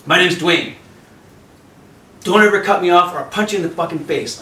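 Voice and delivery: lightly voiced aggressive local guy; threatening surfer. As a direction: imitate the bully surfer warning you off. Tough surfer threatening narrator.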